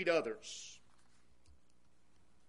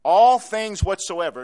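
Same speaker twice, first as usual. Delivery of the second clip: "all things whatsoever" thrown out much louder; loud emphatic preaching; 3 semitones higher, 17 dB louder than usual. Shown as a demonstration. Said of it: "Is there something about those words you don't understand?".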